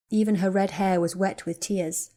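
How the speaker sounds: plain reading voice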